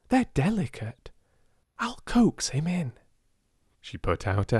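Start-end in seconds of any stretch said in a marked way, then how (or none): 0.1-2.9 high, hushed and gentle; coaxing whisper. Acted. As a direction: whisper so as not to startle. Sarah coaxing the monkey.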